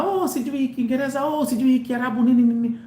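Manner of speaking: high-pitched mimicry of complainers; mock complaint. Mimicking critics who complain about languages, mock complaint. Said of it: "Wako wanasema".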